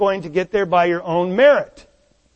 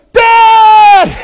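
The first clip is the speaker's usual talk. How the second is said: put-on loud high cry of a child about to crash; child crashing the bike, panicked high shout; kid's shout; 12 semitones higher, 12 dB louder than usual. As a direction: yell Dad in sudden alarm. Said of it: "And they look".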